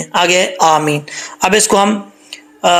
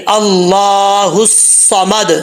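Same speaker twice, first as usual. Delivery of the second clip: slow, drawn-out al Samad; Quranic recitation; much slower than usual. Shown as a demonstration. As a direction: recite Allah al Samad slowly. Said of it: "اچھا جی".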